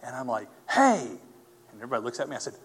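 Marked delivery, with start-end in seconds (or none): 0.7-1.2 calling out. Retold or imitated. Calling out to the hospital staff.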